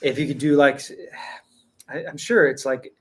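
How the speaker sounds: usual speaking voice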